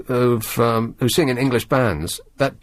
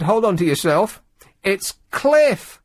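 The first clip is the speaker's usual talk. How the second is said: mock TV reveal; 8 semitones higher, slower than usual. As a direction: announce it like a game-show host. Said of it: "they came back and they said".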